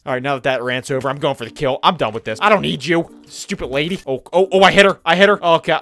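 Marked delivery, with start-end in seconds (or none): none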